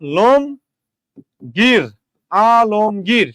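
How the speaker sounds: আলমগীর broken into a, lom, gir; slow model of আলমগীর as a-lom-gir; syllable-by-syllable. Shown as a demonstration. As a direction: stretch and separate the three parts of আলমগীর. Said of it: "উচ্চারণ হবে".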